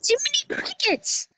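whispered mock British exclamation. To teammates, a whispered mock British gasp. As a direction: breathe it like a secret.

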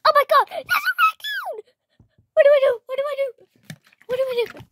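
high panicked squeals; panicked shriek. Acted. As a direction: shriek in terror at the raccoon. Panics at raccoon.

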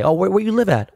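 high, doubting "where you live at"; mock skeptical question. Guy grilling a fake LA native, high mock skepticism. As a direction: play the suspicious asker.

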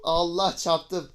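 surprised exclaim. Real, not acted. Just crashed.

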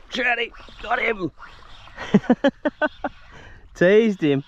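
high, thrilled cry with a giggle; excited yelp. Real, not acted. Angler hooking a fish at the jetty, high excited giggle. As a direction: burst out as the fish strikes.

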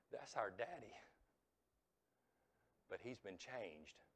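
very soft, low acted quote; hushed recognition. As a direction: let the realisation come out quietly. Children recognise their changed father.

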